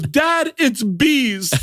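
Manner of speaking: high, strained cry to a parent; high-pitched comic panic; hammed-up alarm. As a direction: imitate a kid wailing a warning. Daughter collapses, announcing bees.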